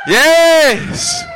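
high, drawn-out cheer; exultant shout. Real, not acted. Preacher stirring up the church, exultant shout. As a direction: cry out in triumph. Whooping during revival.

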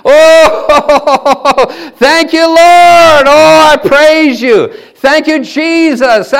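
high, loud, joyful cries to the Lord; ecstatic praise. Real, not acted. Preacher overcome with joy, crying praise aloud. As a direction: cry out thanks in rapture.

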